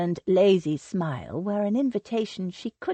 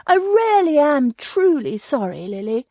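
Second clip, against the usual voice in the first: higher, eager voice of the fussy cousin, apologizing in a rush; an anxious society lady pleading to be forgiven, pitched higher; gushing Sylvia; 7 semitones higher, 7 dB louder than usual. A narrator's reading.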